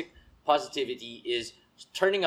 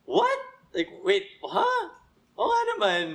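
mock realization. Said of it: "where you're just like".

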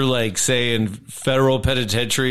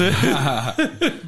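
a short rising laugh; 2 semitones higher than usual. Real, not acted. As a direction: laugh at your own joke. Laughing at own story.

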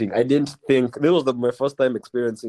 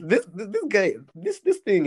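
high, put-on Nairobi cool kid accent; 8 semitones higher than usual. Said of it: "The you guy accent".

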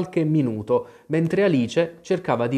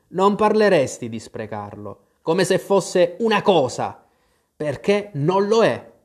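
mildly scolding correction, rising higher; reproachful; 5 semitones higher, 3 dB louder than usual. A narrator's reading. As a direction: reprove her, pushing the pitch up. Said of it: "la rimproverò il cappellaio". (rimprovero contenuto)

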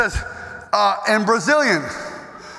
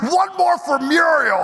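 rallying cry; 5 semitones higher, slower than usual. Retold or imitated. Old man raising arms running laps.

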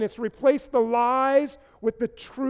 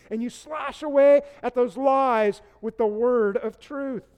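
preaching; 3 semitones higher, 3 dB louder than usual. Acted. Preaches about fighting lies.

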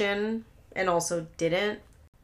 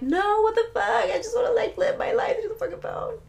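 much higher, mocking teen whine; whiny teenager; 14 semitones higher than usual. Teen whining at mom to keep the phone, mocking high whine. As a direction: play the bratty kid who won't hand over the phone. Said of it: "And you're like".

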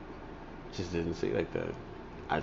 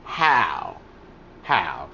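pressing; 5 semitones higher, 8 dB louder, much slower than usual. Retold or imitated. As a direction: hammer the question, letting it fall. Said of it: "She was like".

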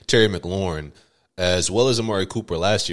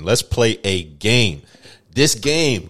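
slow dramatic announcement; 3 semitones higher, 6 dB louder, much slower than usual. Acted. Announcing the game again.

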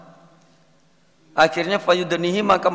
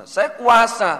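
high proud declaration; 8 semitones higher, 3 dB louder, slower than usual. Acted. God declares his power.

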